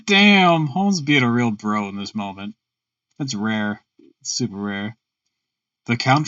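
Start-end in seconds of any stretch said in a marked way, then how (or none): none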